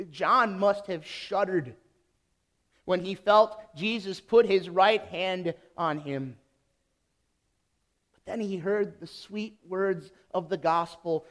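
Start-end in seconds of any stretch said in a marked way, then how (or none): none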